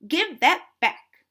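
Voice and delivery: loud, high and demanding, slowing at the end; angry squirrel. Acted. Squirrel shouts at Duck to return the spoon. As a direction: stamp your foot and demand it back.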